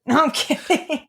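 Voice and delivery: laughing it off, high and slow; teasing laugh while backing off a joke. Real, not acted. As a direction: take back the joke through a laugh. Teasing brother, then laughs.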